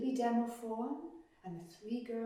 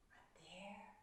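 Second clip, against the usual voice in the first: soft whisper of awe; hushed wonder; 3 semitones lower, 18 dB quieter than usual. A narrator's reading. Storyteller reveals the sunlit world to children, whispered. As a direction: breathe it like a secret revealed.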